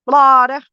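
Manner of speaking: Florida said as Flahda, r dropped; Florida accent. Shown as a demonstration. Livestreamer shows how her Florida relatives say it: Flahda. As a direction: Florida with open ah and no r. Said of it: "That's how people in Florida say it".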